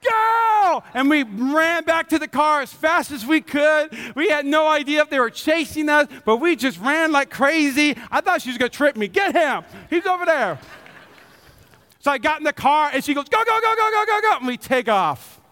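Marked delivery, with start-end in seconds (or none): 0.0-0.8 high, stretched-out shout; yelled command. Retold or imitated. Wife yells the signal to run from security, urgent yell. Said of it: "She just yelled". 9.1-10.6 raised, higher-pitched pointing-out call; mock calling out. Wife imagined betraying husband to security, mock calling out. 13.3-14.4 rapid-fire, high-pitched command; urgent urging. Retold or imitated. Wife urges husband to drive off from security, frantic urging.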